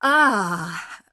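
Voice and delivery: loud, anguished "ah"; merchant's cry. A narrator's reading. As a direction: cry out in dismay. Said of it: "cried the merchant".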